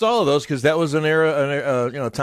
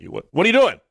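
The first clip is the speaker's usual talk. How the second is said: mock yell, pitched up; retold yelling; 6 semitones higher than usual. Partner catching a dozer on the sofa, mock yelling. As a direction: imitate a partner's raised-voice challenge. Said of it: "Michelle's yelling at me".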